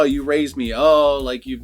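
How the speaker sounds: ordinary talk, nothing marked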